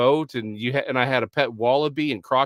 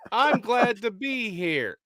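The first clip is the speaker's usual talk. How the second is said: slow, higher Aussie attempt drifting Texan; Australian-gone-Texan, slow and higher; bad Australian accent; 7 semitones higher, much slower than usual. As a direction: draw it out in a botched Australian accent. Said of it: "I'm gonna keep going Texan".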